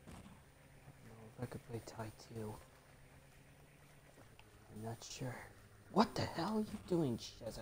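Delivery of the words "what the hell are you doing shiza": high, loud exasperated yell; angry yell. Real, not acted. Gamer at rival Shiza, angry yell. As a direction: blast it at the rival.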